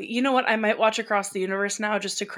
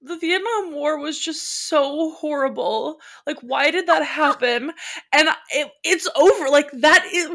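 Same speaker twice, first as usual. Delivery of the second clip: put-on tearful child; 6 semitones higher, 6 dB louder than usual. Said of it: "And I was like".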